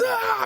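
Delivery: high startled shriek; mock scream from the front seat; mom's startled shriek. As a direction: yelp like a scared mom. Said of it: "My mom goes".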